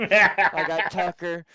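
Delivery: loud and laughing, drawn out; laughing delight. Real, not acted. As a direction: laugh it out, slowly.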